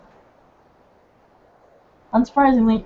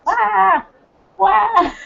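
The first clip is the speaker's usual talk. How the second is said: high playful "wah" cry; startling boo; 10 semitones higher than usual. Retold or imitated. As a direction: mimic the bird's startle, yelping. Mimicking startled bird.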